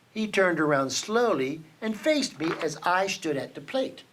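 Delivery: plain reading voice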